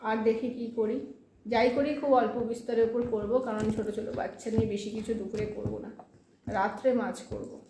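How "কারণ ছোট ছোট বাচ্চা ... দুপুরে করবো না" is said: usual speaking voice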